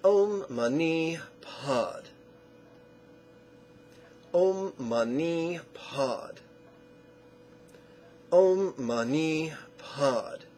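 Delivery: "Om Mani Pad" very slow, each vowel drawn out; syllable stacking. Shown as a demonstration. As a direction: recite "Om Mani Pad" as a measured drill, falling at the end. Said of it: "Four syllables".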